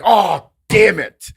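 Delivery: loud, high, drawn-out exasperated cry; mock frustration. Players react to a bad shot, mock frustration. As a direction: curse a missed shot, hammed-up. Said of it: "'cause a bad shot or something".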